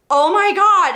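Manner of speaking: reacting to a twist for her live viewers; shocked gasp. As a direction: react like your jaw just dropped.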